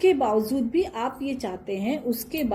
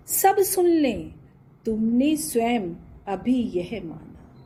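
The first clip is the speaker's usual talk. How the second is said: recited verse; 2 semitones higher than usual. Shown as a demonstration. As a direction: declaim the verse line slowly. (कविता का धीमा पाठ)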